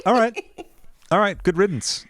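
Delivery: high, laughing all right, good riddance; mock dismissive farewell. To the ex, a gleeful high good riddance. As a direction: wave him off with a laugh.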